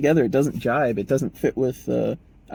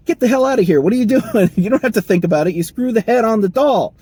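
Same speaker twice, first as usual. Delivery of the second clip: much higher, louder mock yell, faster at the end; furious boss yelling; 10 semitones higher, 8 dB louder than usual. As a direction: blow up at the worker like an outraged foreman. Manager yells at thinking worker.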